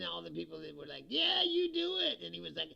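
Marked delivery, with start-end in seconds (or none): 1.1-2.2 much higher, louder, slow egging-on; hammed-up eager backers; mock cheerleaders. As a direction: egg him on in a silly high voice. Imitating cheering supporters.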